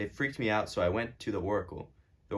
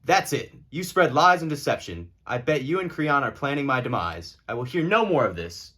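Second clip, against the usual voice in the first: higher, louder angry accusation; furious outburst; 4 semitones higher, 6 dB louder than usual. Acted. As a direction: lash out at the prophet. King furious at the prophet.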